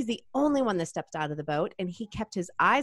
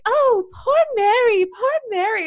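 high, slow, fluting fake sympathy; mock pity; 14 semitones higher, 8 dB louder, slower than usual. Onlookers fussing over Mary, high mocking pity. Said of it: "and everyone's like".

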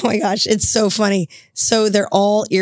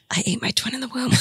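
hushed, creepy whisper; 5 semitones higher, 8 dB quieter than usual. Retold or imitated. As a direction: breathe it like a spooky secret. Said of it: "what'd she say".

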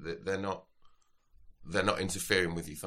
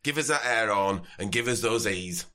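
hammed-up sozzled Yorkshire lad; drunk Yorkshireman; 4 semitones higher, 6 dB louder than usual. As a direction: drunk Yorkshire festival-goer demanding a wristband. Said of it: "determined slasher from Yorkshire".

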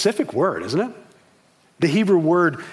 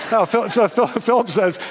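restarting with a laugh; amused retake after a joke; 7 semitones higher, 4 dB louder than usual. Real, not acted. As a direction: chuckle through the words.

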